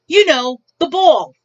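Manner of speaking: loud, impatient mock exasperation; annoyed customer. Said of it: "like, annoyed".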